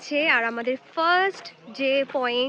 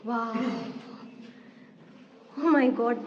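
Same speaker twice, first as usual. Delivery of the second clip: awed surprise; 3 semitones lower, much slower than usual. Real, not acted. Entering the dark cave.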